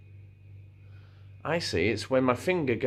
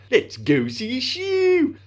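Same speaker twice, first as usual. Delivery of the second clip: high, loud, drawn-out silly voice; 13 semitones higher, 5 dB louder, much slower than usual. Retold or imitated. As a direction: squash the vowels and send the last word sky-high. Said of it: "the silly line".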